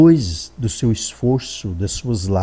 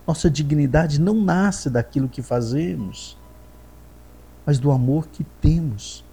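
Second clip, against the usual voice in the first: higher, lifted preaching voice building with feeling; fervent preaching; 5 semitones higher than usual. Acted. Preacher urging the faithful they are loved, fervent. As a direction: lift the congregation with rising fervour.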